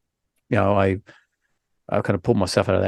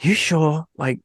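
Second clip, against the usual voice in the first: lightly voiced wife, higher pitch; imitating doubtful wife; 7 semitones higher than usual. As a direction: gently mimic your wife's doubtful questioning. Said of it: "She's like".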